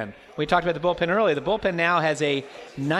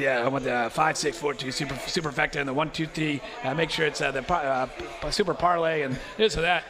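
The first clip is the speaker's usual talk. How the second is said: lightly imitated raspy voice; a hint of a raspy old baseball guy; Johnny Padres raspy voice; 3 dB quieter than usual. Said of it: "you know, the raspy voice".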